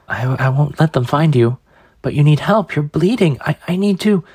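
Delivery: put-on high, anxious stammering boy; frightened pleading.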